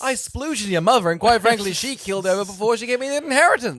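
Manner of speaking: loud, high hammed-up outburst; mock dad rant. Inventor dad telling off his kids, loud mock rant. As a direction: rant as an outrageous bitter father. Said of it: "It's like".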